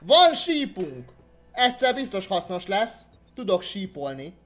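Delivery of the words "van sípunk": high, loud, drawn-out exclamation; excited raised-voice cry; excited discovery. Real, not acted. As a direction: announce the find with delight. Finds a whistle in game.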